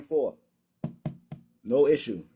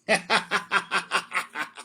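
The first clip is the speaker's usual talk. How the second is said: real laugh. Real, not acted. Mocking opponent's argument.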